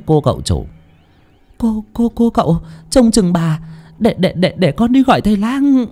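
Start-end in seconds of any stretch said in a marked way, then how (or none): 1.6-5.9 stammering. Acted. Servant stammers urgent instructions.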